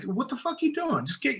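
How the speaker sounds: high-pitched mock confrontation; retold angry outburst. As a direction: snap at the cabbie, retold. Sticking up for the lady.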